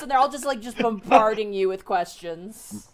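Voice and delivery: ordinary talk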